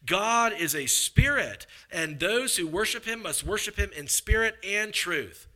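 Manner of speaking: preacher proclaiming a verse, drawn out and high; emphatic scripture reading. Acted. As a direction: drive each phrase home, slow and loud.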